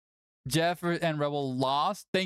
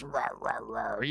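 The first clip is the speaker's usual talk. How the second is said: soft mock roar; 7 semitones lower, 5 dB quieter, slower than usual. Feeding the "dragon" cat.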